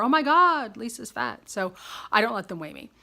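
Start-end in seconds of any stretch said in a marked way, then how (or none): 0.0-1.5 mock shock. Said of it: "like they're gonna discover".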